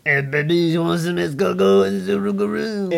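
mock French accent.

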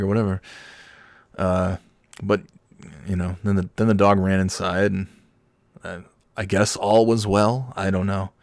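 ordinary delivery

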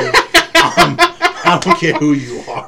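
loud, high mock threat through laughter; mock outrage. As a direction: draw a line in playful outrage, loudly. Mock outrage at toasting order.

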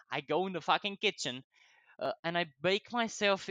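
ordinary talk, nothing marked

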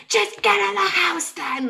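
high, slow, exasperated yell, partly imitated; half-mock panicked order to get inside; freaking out. Yelling at her friend to just get in the house, freaking out. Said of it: "like, freaking out".

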